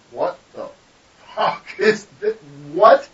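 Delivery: retold disbelief, jumping high and loud; astonished. Wife on opening the old portfolio, astonished. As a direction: imitate a stunned, halting double take.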